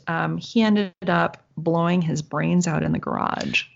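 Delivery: usual speaking voice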